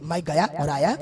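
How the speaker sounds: calm his worried wife softly; reassuring Ram. Acted. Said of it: "भगवान राम कहते हैं".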